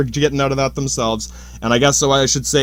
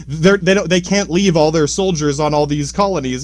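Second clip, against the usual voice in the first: normal talking voice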